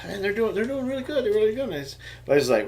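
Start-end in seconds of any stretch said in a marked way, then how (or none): 0.0-1.6 quoting himself. Retold or imitated. Dad quotes his own fib.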